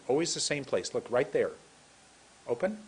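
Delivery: ordinary delivery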